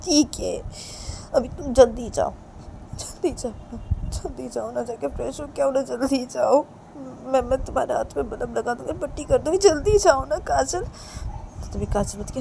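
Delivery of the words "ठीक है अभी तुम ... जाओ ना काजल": plaintive pleading. Acted. Reshma promises not to cry and urges Kajal, pleading.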